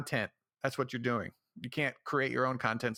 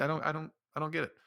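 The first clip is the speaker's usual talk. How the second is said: ordinary talk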